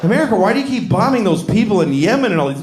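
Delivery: ordinary talk, nothing marked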